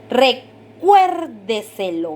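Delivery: "Recuérdeselo" very slow, "cuér" punched after a held "Re"; stressed syllable demo. Shown as a demonstration. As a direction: break after "Re", then hit "cuér" in "Recuérdeselo". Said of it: "se escucha el golpe de voz en esta sílaba". ("Recuérdeselo" muy lento, marcando con fuerza "cuér")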